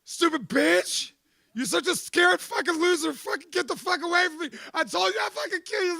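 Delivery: high, loud, scornful yelling; raging rant. Retold or imitated. Man acting out screaming at his girlfriend. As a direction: tear into her, contemptuous and loud. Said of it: "And then I'm like".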